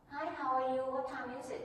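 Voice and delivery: high-pitched attempt at American small talk; American accent attempt. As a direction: try on a light American accent. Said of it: "You know, American accent, something like that".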